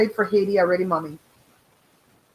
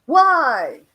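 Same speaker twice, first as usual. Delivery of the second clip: louder, exasperated mock envy; 5 semitones higher, 7 dB louder, much slower than usual. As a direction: playfully protest her beauty. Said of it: "I'm like".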